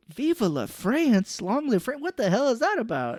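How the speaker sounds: mock bewildered question; incredulous reaction. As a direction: repeat it in disbelief. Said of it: "I'm like".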